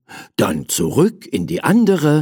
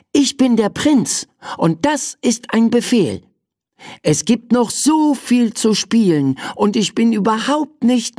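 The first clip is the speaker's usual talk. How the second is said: put-on high-pitched child prince giving orders; bossy little prince; 8 semitones higher than usual. Prince ordering his servant at bedtime, high and insistent.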